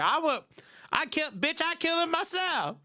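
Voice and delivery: high-pitched mock outraged listener. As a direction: pitch it way up as an indignant fan. Acts out angry podcast listeners.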